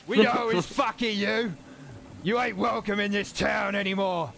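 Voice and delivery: high, hostile yell; mob shouting back. Acted. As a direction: shout him out of town. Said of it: "a shouting match between him and the mob".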